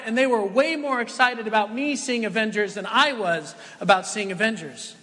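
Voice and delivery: ordinary talk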